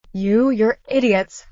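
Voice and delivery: usual speaking voice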